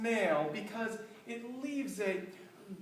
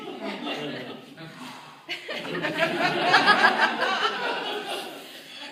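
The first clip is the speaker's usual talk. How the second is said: high laughing fit; real laughter =26; long laugh; 9 semitones higher, 6 dB louder than usual. Real, not acted. Laughs after the rant.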